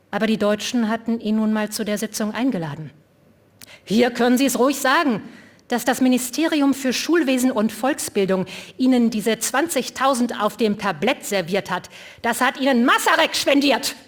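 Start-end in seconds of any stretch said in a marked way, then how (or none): 3.8-14.0 louder, higher pointed challenge; called out. A narrator's reading. Liborius calls out at the mayor, loud and accusing. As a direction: call it out across the room. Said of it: "rief Liborius".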